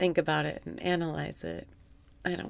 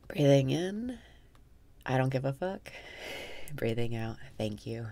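guided meditation; 3 semitones lower, 5 dB quieter than usual. Acted. Guiding listeners through breathwork in a soft meditation voice. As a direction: guide the breath calmly, half amused.

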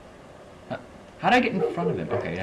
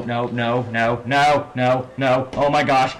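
normal talking voice

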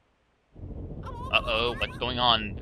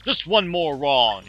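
mock angry yell; 4 semitones higher, 5 dB louder than usual. Host yells at contestant.

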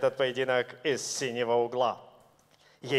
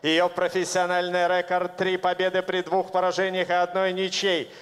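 ordinary talk, nothing marked

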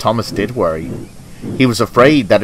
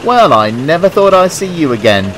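higher, louder storybook engine voice; character calling out; 7 semitones higher, 5 dB louder than usual. A narrator's reading. Old engine hailing Thomas from inside the shed, high and loud. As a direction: pitch it up and call over in greeting. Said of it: "came a voice from inside the shed".

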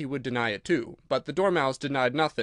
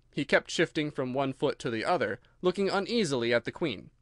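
ordinary delivery, nothing marked

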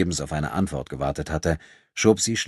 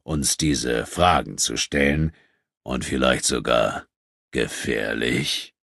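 slow, lower-voiced menace; veiled threat; 5 semitones lower, slower than usual. A narrator's reading. Questioning the innkeeper, slow low veiled threat. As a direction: draw it out low and slow, letting the danger hang.

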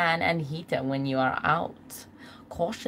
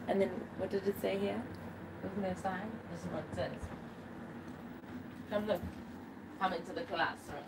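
quiet; 12 dB quieter than usual. Real, not acted. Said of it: "we can't be too loud because the walls are very thin".

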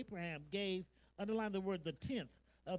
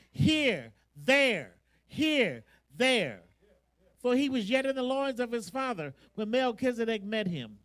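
loud, high, impassioned sermon; full-force preaching; 4 semitones higher, 11 dB louder than usual. Real, not acted. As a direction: build to a ringing pulpit shout, stretching key words.

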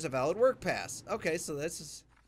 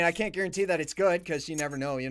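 ordinary talk

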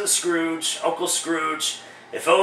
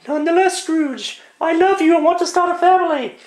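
put-on much higher young woman's voice; falsetto girlfriend; 11 semitones higher than usual. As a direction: plead as the girlfriend in a high voice. Girlfriend pleads again.

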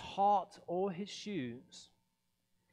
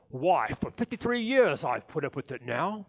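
louder, fast exasperated rant; 9 dB louder, faster than usual. Acted. The Grinch fed up with Christmas, a fast outburst. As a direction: blurt it out fed up.

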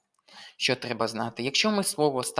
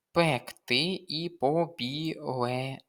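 "пекти і побілений" slowly, syllable by syllable; slow sounding-out; slower than usual. Shown as a demonstration. Teacher reading test words for spelling, slowly sounded out. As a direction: spell it out by ear, drawing out "побілений".

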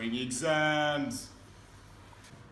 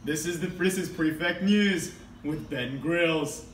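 normal talking voice

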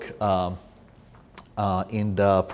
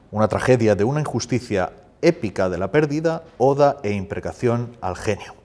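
measured list of phrases, emphatically declaimed; formal reading in Spanish; 5 dB louder, faster than usual. Acted. Translator reads his Spanish introduction to audience, formal declaimed reading.

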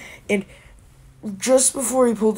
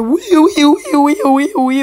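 high, loud whooping siren; rapid wailing siren noise; imitated siren; 6 semitones higher, 8 dB louder than usual. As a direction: squeal like a police siren. Imitates reinforcement sirens arriving.

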